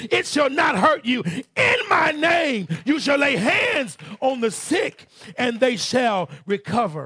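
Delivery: loud, high revival preaching; fiery pulpit proclamation; preaching at full force. Acted. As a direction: thunder the scripture out to the church.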